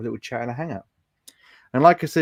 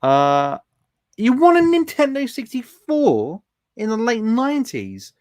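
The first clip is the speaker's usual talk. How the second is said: excited chat read; 9 semitones higher, 6 dB louder, slower than usual. Retold or imitated. Reading chat comment in excited voice.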